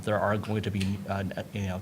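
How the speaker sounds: normal talking voice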